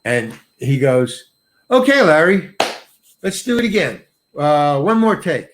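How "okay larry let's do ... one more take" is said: higher, raised call from the camera; director calling out. Retold or imitated. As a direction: call across a distance to the actor. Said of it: "the camera's this far away".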